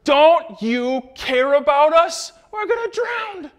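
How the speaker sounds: high, slow, frightened cry to the teacher; panicked disciples. Retold or imitated. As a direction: plead in terror as the boat sinks.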